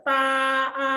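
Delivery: TA and A held high and level; long, unwavering high TA; first tone TA. Shown as a demonstration. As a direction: sustain TA, then A, on one high pitch. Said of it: "Yang tinggi terus datar".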